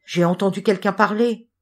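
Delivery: usual speaking voice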